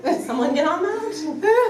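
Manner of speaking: playful demand. Pretend. Joking demand for indoor pool.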